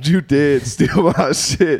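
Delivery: laughing through the words; slow, laughing accusation. Real, not acted.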